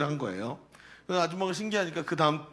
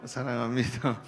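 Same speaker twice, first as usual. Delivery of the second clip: laughing; 4 semitones lower, 7 dB quieter than usual. Real, not acted. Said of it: "시작".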